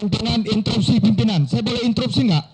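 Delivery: calling out loudly over the chair; a loud, insistent bid to interrupt parliament's chair; loud interruption. Real, not acted. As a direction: demand the floor at full volume. Member loudly demands to interrupt.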